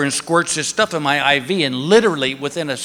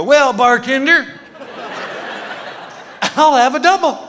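tipsy order; 8 semitones higher than usual. Retold or imitated. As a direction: order like a happy drunk. Drugged joke to nurse.

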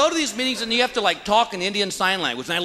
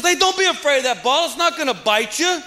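higher-pitched mock yell from the sidelines; yelling dad; 7 semitones higher than usual. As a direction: imitate a dad hollering to his son. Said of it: "I heard a guy yelling at his son".

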